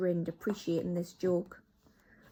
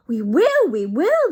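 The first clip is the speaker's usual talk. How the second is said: much higher, loud eager chorus; answering witches; 9 semitones higher, 14 dB louder, slower than usual. Acted. Witches answer together, high loud chorus. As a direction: agree as a crowd. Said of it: "they answered".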